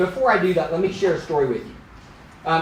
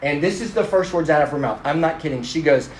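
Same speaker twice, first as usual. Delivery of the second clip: ordinary talk, nothing marked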